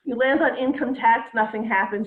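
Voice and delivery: ordinary talk, nothing marked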